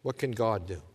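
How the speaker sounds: ordinary talk, nothing marked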